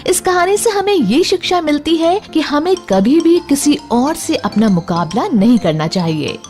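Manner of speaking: ordinary delivery, nothing marked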